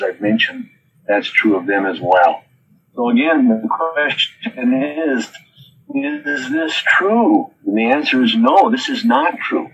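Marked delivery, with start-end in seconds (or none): 3.5-7.5 very slow, drawn-out question, the last word much higher; a deliberate preacher's pause-laden question; slow, weighty question. Real, not acted. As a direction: spell it out slowly for the congregation. Pastor poses the key question.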